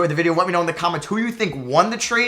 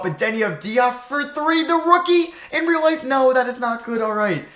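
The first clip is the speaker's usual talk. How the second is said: ordinary talk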